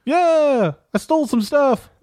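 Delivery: high-pitched comic confession; sheepish crucified thief. Acted. Crucified thief admits stealing to visitor, high-pitched and sheepish.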